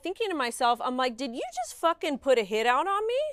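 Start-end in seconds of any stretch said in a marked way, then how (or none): 1.2-3.2 high, loud disbelieving outburst; can't believe what she's reading; shocked outburst. Retold or imitated. Reacting to a follower's threat, loud disbelief.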